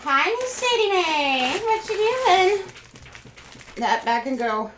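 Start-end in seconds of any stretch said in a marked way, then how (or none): 0.1-2.6 pet-talk voice. Real, not acted. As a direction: coo at the dog in a sweet voice. Greeting her pet.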